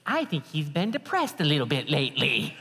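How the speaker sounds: lightly imitated wife chiming in; a hint of put-on wife voice; Tracy. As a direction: pipe up as the tattling wife. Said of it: "Tracy pipes up from the side seat".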